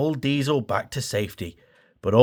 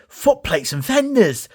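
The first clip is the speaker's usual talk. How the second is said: high, bright burst of joy; excited exclamation; 8 semitones higher than usual. Acted. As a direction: exclaim it with delight. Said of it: "Thomas exclaimed".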